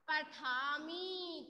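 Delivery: पठामी stretched very slowly with a falling end; slow pronunciation demo. Shown as a demonstration. Teacher slowly models पठामी before class repeats. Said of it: "तो क्या हो जाएगा ये?".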